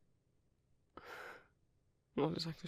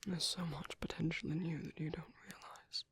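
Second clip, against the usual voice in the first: tender whisper. Acted. Sanctuary keeper comforting a tearful young man, tender whisper. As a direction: hush him gently like soothing a hurt child.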